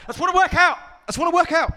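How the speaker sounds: high, loud boastful voice; proud gym man. Acted. Naked man in changing room, high loud bragging. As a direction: show off your body to everyone.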